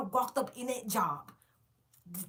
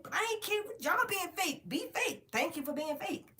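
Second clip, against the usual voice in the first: high mock sweetness; 9 semitones higher than usual. Mocking fake coworkers.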